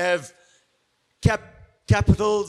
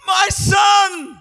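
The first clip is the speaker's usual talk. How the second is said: high, loud wail of my son; anguished cry; 11 semitones higher, 8 dB louder than usual. Retold or imitated. King David cries out over his son, loudly wailing. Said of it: "In reality, he was saying".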